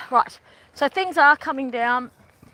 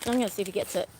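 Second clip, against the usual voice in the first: ordinary talk, nothing marked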